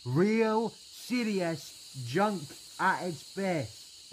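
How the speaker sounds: high, slow, drawn-out scorn; exasperated rant. Acted. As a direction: stretch out each scornful word. Ranting about the useless Wi-Fi.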